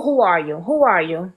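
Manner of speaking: ordinary talk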